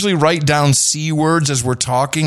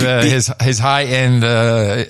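normal talking voice

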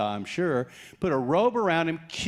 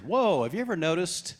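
ordinary talk, nothing marked

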